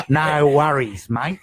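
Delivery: broad, hammed-up Aussie ocker voice; Australian accent. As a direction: lay on a thick Australian drawl. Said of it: "that's my Australian accent".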